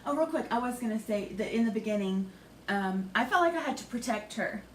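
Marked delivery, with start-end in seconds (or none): none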